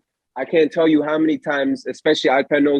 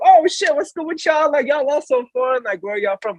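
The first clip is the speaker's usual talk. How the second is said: excited greeting; 13 semitones higher than usual. Retold or imitated. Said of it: "I'm just like".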